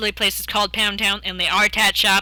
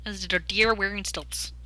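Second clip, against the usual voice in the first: ordinary talk, nothing marked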